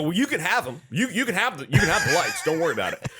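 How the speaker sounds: imitating his own flustered pleading; nervous offer. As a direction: act out nervously giving in, half laughing. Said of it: "I'm nervous".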